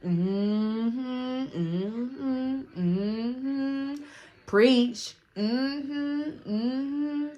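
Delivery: high, rising hummed agreement, imitating a friend; sing-song mock cheering-on; approving amen. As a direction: egg the speaker on like a congregation. Imitating Daisy egging her on.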